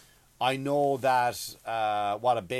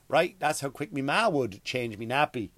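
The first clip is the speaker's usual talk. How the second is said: ordinary talk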